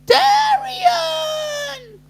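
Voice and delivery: shrieked name, very high and loud; high, loud, ecstatic call of Darian; excited shout. Retold or imitated. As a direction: scream the name like an overjoyed fan. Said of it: "Danny's going".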